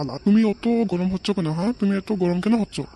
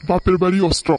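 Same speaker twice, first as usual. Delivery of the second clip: louder, punchy title card read; title announcement; 5 dB louder than usual. Acted. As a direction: announce the weapon like a show segment.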